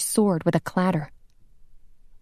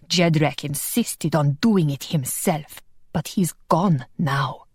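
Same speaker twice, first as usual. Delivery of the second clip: a hint of a low sneering villain; lightly voiced villain; 3 semitones lower than usual. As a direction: blade at her throat, a villain's mild snarl. Sigismund threatens Wanda.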